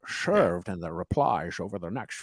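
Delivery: put-on slushy Sean Connery-style Scottish accent, S turned to SH. Podcast host reads the article aloud on co-host's dare, Sean Connery accent. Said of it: "maybe a Sean Connery accent you could go with".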